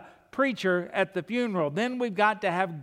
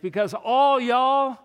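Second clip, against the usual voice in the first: slow Southern "all y'all", louder and higher; 6 semitones higher, 8 dB louder, slower than usual. Shown as a demonstration. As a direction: lean into the home-grown "all y'all". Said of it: "in the way I was raised".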